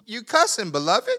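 loud, high mock rebuke of a cussing saint; mock scold. Preacher teasing the congregation about cussing, loud mock scold. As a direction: call them out with a teasing shout.

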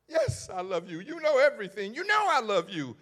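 insist, rising on know; Peter insisting. Acted.

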